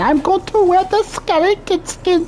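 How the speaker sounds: very high, squeaky mimicry of a fan; high mocking fangirl voice; mocking copycat voice. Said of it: "They're like".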